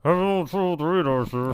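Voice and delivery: mouth-made muffled announcement; garbled intercom. Retold or imitated. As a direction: slur it like a broken radio. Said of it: "You don't need that".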